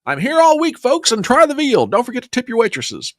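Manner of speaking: high-pitched mock stand-up sign-off; milk the bad pun like a lounge comedian; hammy club comic.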